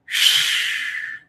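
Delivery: slow audible exhale to release tension; demo sigh. Shown as a demonstration. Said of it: "get all the bullshit out of you".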